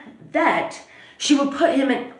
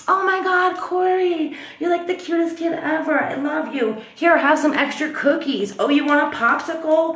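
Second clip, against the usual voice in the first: high-pitched, syrupy mock fawning; gushing over a child; 5 semitones higher than usual. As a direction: imitate an adult cooing over a cute kid. Girlfriend gushes over the little boy.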